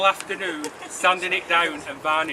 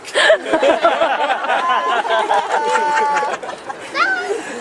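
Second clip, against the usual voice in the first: laughing; 8 semitones higher, 7 dB louder than usual. Real, not acted. Laughs at his joke.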